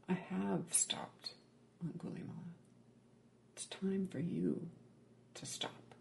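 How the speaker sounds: soft, direct and calm, a quiet firm stop; the Buddha's calm fierce reply. Retold or imitated. Buddha calmly halting the murderer chasing him, soft and firm. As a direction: say it softly but without a flicker of doubt. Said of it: "Direct, fierce, calm, compassion".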